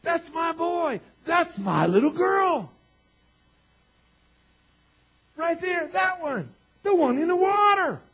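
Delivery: high, loud, drawn-out holler; God's delighted shout. Acted. God delighting in his children, exultant shout. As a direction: cry out like a proud parent in the stands. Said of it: "look at you and go".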